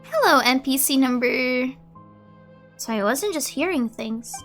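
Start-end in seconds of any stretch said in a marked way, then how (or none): none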